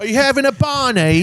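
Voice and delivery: high, loud mock Brit; British accent. Brit picking a row, mock British accent. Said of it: "just like every British thing ever".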